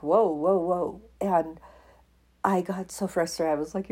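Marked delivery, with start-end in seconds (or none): 0.0-1.0 a louder shocked whoa from the group; mock stunned whoa. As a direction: react as if floored. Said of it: "they're all like".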